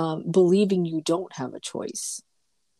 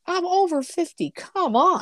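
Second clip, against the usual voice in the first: much higher and louder, exasperated; fed-up woman over fifty, higher and louder; indignant; 11 semitones higher, 4 dB louder than usual. Real, not acted. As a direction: throw it out in disbelief. Indignant about men's come-ons.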